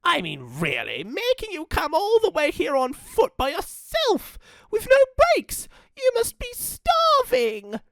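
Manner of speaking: put-on apologetic old gran; fussy granny voice. Gran voice held.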